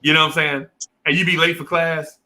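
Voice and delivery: ordinary talk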